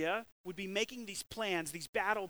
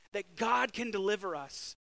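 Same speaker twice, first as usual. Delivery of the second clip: usual speaking voice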